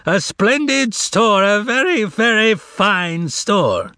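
high, slow, grand proclamation; booming voice. A narrator's reading. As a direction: boom it to the whole store. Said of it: "announced in a booming voice".

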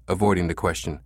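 usual speaking voice

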